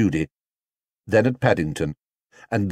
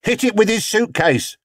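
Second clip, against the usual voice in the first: high-pitched call from the crowd; raised crowd voice; shouted; 13 semitones higher than usual. A narrator's reading. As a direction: shout it out over the noise. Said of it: "shouted another voice".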